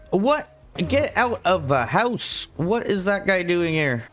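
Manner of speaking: high, outraged startled shout; indignant surprise. Real, not acted. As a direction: caught off guard, shoo it out. Mob found inside house.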